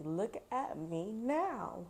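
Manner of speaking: slow, stretched show-off; showy drawl. Acted. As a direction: draw it out for the crowd. Cat showing off.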